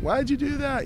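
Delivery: put-on high, tearful little boy's wail; crying kid. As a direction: squeak it out like a hurt small kid in tears. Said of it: "he started crying".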